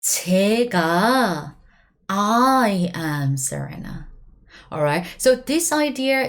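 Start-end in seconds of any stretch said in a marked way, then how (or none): 0.0-4.1 제가 said slowly and firmly with weight; slow stressed example. Shown as a demonstration. Teacher to students stressing 제가 slowly. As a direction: lean on 제가 slowly like saying I am Serena. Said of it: "Imagine like this".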